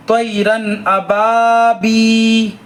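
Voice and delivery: very slow, elongated reading; slow recitation. Shown as a demonstration. Teacher demonstrating to pupils, slow chant. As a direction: draw every syllable out for the class. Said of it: "teacher models recitation".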